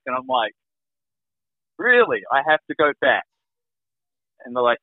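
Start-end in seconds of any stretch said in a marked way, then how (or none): 1.8-3.2 high, disbelieving retold question; stunned, higher-pitched disbelief; incredulous. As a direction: question it with pitch leaping on the first word. Retold disbelief at being sent back.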